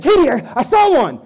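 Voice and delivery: high, loud excited call; husband calling out to wife about the mouse, loud and high; excited alarm. Retold or imitated. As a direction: yell it like you've spotted the mouse. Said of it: "And I said".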